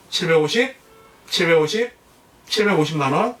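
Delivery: high rising repeated bid calls; auctioneer call. Shown as a demonstration. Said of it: "시범을 한번 보여드려 볼게요".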